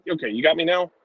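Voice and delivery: normal talking voice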